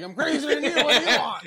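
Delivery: hammed-up crazed cook; unhinged cook. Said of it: "a cook has to come out and be like".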